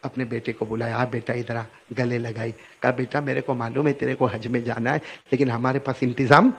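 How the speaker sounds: soft, hushed and gentle; a loving mother quietly comforting her son; tender mother. Retold or imitated. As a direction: soothe the child softly. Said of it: "پھر ماں کو رحم آیا".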